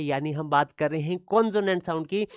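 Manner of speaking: ordinary talk, nothing marked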